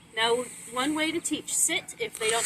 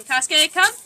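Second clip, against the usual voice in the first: calling out loudly; 5 semitones higher, 12 dB louder than usual. Real, not acted.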